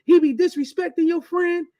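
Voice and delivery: high, loud scolding wife voice; mock the wife spazzing out; hammed-up angry wife; imitating upset wife. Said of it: "my wife was like".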